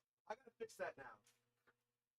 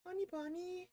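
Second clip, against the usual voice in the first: sweet, high pet-name coo. Real, not acted. Cooing a pet name to the cat.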